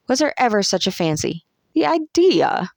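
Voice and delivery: rising indignation, peaking high on the last words; scandalised, pitch leaping up at the end; indignant. A narrator's reading. Camilla reacting with contempt as the boy leaves, indignant. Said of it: "with indignation".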